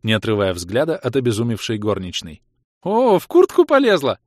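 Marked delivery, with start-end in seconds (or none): 2.8-4.2 very high, loud thrilled squeal. A narrator's reading. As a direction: squeal with delight at the scandal. Chloe watching, thrilled.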